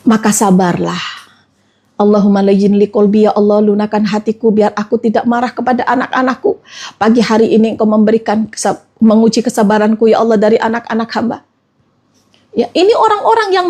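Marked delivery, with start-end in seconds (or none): 2.0-11.4 low, earnest murmured prayer. Acted. Models prayer for a soft heart.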